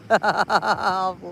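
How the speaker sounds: soft run of giggles; cracking up. Real, not acted. Killing herself laughing at her story, soft giggles. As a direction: keep chuckling at your own joke. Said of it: "Killing myself laughing".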